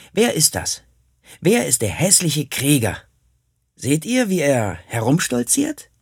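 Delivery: höher, spöttisch den Krieger nachäffend; spöttische Nachahmung. Retold or imitated. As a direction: sich über den stolzierenden Krieger lustig machen. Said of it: "Ich ahmte diesen Krieger nach, um mich über ihn lustig zu machen". (Junge äfft vor den Freunden den Krieger nach, spöttisch)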